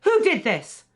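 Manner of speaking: high, loud accusing question; angry demand. Acted. Mother confronting whoever put a filter on, angry.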